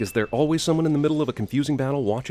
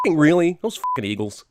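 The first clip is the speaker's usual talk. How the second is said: exasperated shout; 3 semitones higher, 4 dB louder than usual. Real, not acted. Exasperated outburst.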